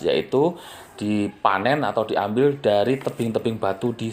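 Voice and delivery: honey seller pitching to viewers, ad read. Acted. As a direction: read the ad like a promo spot. Honey ad read mid-video.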